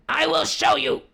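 loud, high tiger shout. Acted. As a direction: bellow in fury. Tiger loses patience.

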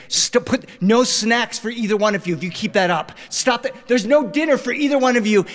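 high, strained parental scolding; dad at the end of his patience; exasperated threats. Acted. Dad threatens fighting kids.

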